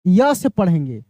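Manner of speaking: य said clearly as a y sound, low and firm; pronounce with य sound. Shown as a demonstration. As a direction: show that EWE starts with य. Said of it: "यानी य से इसको हम लोग पढ़ेंगे".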